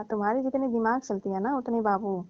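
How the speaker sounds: normal talking voice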